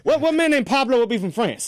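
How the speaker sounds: high-pitched disbelieving challenge; incredulous. Real, not acted. Co-host challenging the wrong name for a French director, incredulous.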